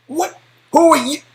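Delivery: high, loud shocked guard; a guard caught off guard, high and loud; startled yelp. Acted. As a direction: squeal it out in alarm.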